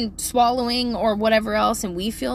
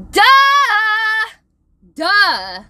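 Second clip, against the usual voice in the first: loud, drawn-out duh; screamed duh; 10 semitones higher, 9 dB louder than usual. Acted. Parent venting about whining son, mock scream. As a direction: holler the obvious at him. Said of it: "Is all I wanna scream at him".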